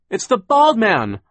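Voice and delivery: high, loud, drawn-out cry. A narrator's reading. Violet recognizes the bald man, crying out high and loud. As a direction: call out the alarming discovery. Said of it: "Violet cried".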